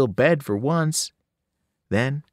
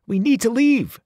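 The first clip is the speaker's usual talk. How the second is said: urgent plea; 10 semitones higher, 5 dB louder than usual. Acted. Urging her to leave the casino.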